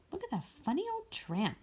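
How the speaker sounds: put-on a kid taunting to his pals; mocking street kid. A narrator's reading. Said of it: "one cried to his playmates".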